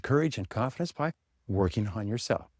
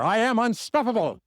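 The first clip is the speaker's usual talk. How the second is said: much higher and louder rallying declaration; said like you mean it, once more; 10 semitones higher, 3 dB louder than usual. Shown as a demonstration. Speaker urging the crowd once more, proclaiming it loudly. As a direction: belt "I am unstoppable" like a rally cry, "am" stressed. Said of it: "Once more".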